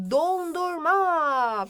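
"Dondurma" slow, with long o and long final a; stretched sounds. Shown as a demonstration. Teacher presenting a new picture, drawn-out "Dondurma". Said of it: "çok fazla vurguluyorum".

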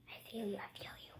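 soft unvoiced whisper; hushed, close to the mic. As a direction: breathe it intimately. Whispered to God.

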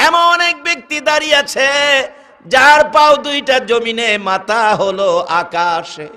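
high, dramatic preacher's cry; awestruck proclamation. Acted. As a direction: proclaim the towering sight to the crowd. Fatema describes the towering stranger.

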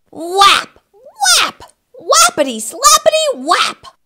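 high, punchy whacks; playful high-pitched sound effects; comic slapping sounds. Acted. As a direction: punch each word like a cartoon slap. Acted slapping noises in the story.

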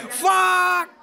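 high, drawn-out yelled curse; long exasperated cry; frustrated shout. Real, not acted. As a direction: yell it as the game goes wrong. Shouted curse at game mishap.

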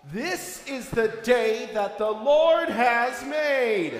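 slow, loud call with weight on Lord; emphatic call. Acted. Leader cueing the children with a slow emphatic call. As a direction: draw out Lord to cue the kids.

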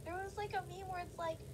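usual speaking voice

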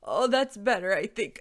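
relieved dragon, loud at first; tentative relief after sneezing; allergic old dragon. A narrator's reading.

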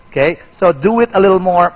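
usual speaking voice

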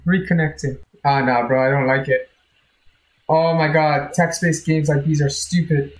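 ordinary talk, nothing marked

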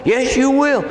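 high, forceful comeback; insistent exorcist. Retold or imitated. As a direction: re-enact arguing back, pitch jumping up. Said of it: "I go".

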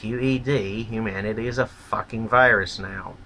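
ordinary delivery

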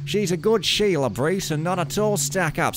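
a deliberately bad Aussie impression; bad Australian accent. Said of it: "if I use a really bad Australian accent".

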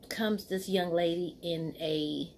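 normal talking voice

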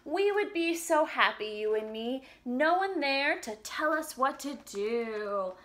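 slow, sing-song storybook reading; reading to children. Acted. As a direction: read the lyrics gently to little kids. Reads song lyrics to children.

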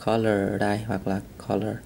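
usual speaking voice

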